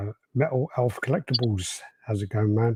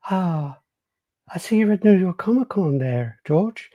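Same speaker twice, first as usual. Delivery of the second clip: high put-on voice, fishing; impression of a caller; 9 semitones higher, slower than usual. Caller angles for George's attention, high put-on voice. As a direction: keep it pitched up, leading up to a favour. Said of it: "that's, like, my impression of him".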